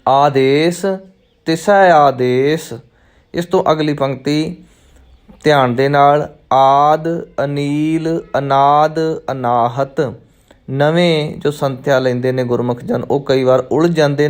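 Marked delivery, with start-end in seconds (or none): 0.1-2.7 first ਆਦੇਸ alone, a clear break, then ਤਿਸੈ ਆਦੇਸ; pause after ਆਦੇਸ. Shown as a demonstration. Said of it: "ਪਹਿਲੇ ਆਦੇਸ਼ 'ਤੇ ਵਿਸ਼ਰਾਮ ਲੱਗੇਗਾ". 6.5-10.1 ਆਦ, ਅਨੀਲ, ਅਨਾਦ, ਅਨਾਹਤ each said separately; word by word. Shown as a demonstration. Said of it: "ਇਹਨੂੰ ਕੱਲਾ ਕੱਲਾ".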